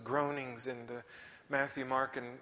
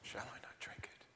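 soft whispered question; hushed resolve; 13 dB quieter than usual. Acted. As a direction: let it fade to a whisper. Whispered close of quote.

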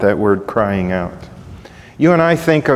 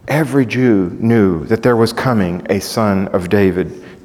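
ordinary delivery

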